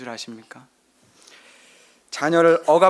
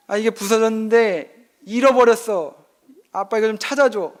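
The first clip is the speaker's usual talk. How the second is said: put-on higher, childish whine of a small son; whiny child; 7 semitones higher than usual. Son begging dad to find the Lego piece, whiny child voice. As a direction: plead like a crying little boy.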